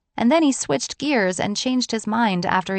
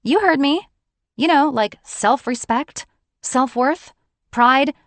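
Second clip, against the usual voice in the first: much higher, louder indignant lecture; 6 semitones higher, 5 dB louder than usual. A narrator's reading. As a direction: list the words with rising indignation. Defiant heated lecture to John.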